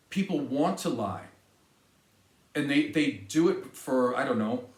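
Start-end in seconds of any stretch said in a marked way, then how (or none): none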